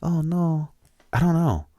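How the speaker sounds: high mock old Mexican lady voice; a cleaning lady fretting; old lady accent. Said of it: "old Mexican a-, lady accent that I'm giving".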